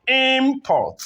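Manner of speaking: Import with IM high, PORT dropping; stress on first syllable. Shown as a demonstration. Teacher showing the noun, first-syllable stress on Import. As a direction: punch the IM of Import. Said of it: "is on the first syllable".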